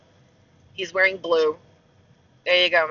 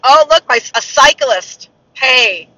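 high, loud burst on spotting something; surprised exclamation; 5 semitones higher, 9 dB louder, slower than usual. Real, not acted. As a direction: call out in sudden distraction. Spots a cyclist while driving.

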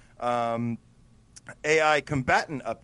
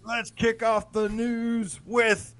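announcer; 10 semitones higher, 4 dB louder, slower than usual. Acted. Opening the news segment.

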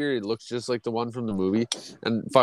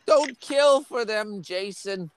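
high, crooning mother's voice; Missus Voorhees; 10 semitones higher, slower than usual. Retold or imitated. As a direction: coo it high like a doting, creepy mother. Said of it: "That's what Missus Voorhees always used to say".

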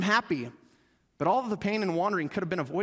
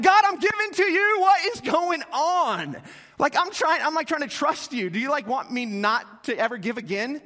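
louder, higher frustrated plea, fading at the end; exasperated outcry; 5 semitones higher, 5 dB louder than usual. Retold or imitated. Young pastor protesting to God after his car breaks, exasperated loud outcry. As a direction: throw up your hands and cry out at God.

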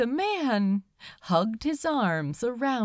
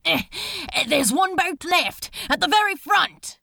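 loud, higher and fast; shouting over the din; 8 semitones higher, 5 dB louder, faster than usual. A narrator's reading. Crowley spotting the last lifeboat, shouting over the din. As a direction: call out over a panicking crowd. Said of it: "he shouted over the din".

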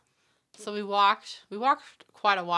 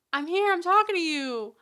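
mock stranger; 9 semitones higher, 8 dB louder than usual. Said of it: "the guy was like".

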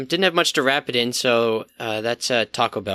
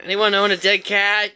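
calling out loudly; hollering in a forest; 9 semitones higher, 4 dB louder than usual. Acted. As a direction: holler it down the street. Calling out down the street.

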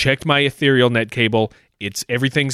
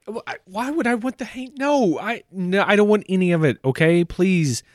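exasperated; 7 semitones higher than usual. Acted. Caller losing patience with upsells.

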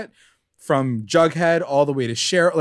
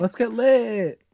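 party voice; 6 semitones higher, much slower than usual. Retold or imitated. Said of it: "He's like".

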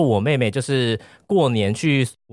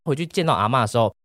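ordinary talk, nothing marked

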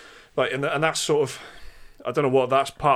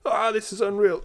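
hyped-up YouTuber; 9 semitones higher, 4 dB louder than usual. Retold or imitated. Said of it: "you gotta be going".

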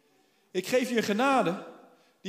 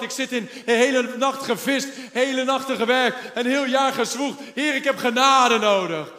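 louder, fervent plea to the Lord; pleading prayer; 3 semitones higher, 7 dB louder than usual. Acted. Believer praying for Jesus to enter their life, fervent loud plea. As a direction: cry out for help.

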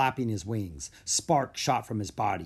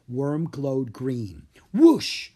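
usual speaking voice

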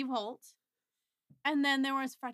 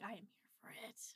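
whispered; 4 semitones lower, 21 dB quieter than usual. Retold or imitated. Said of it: "Maggie's like".

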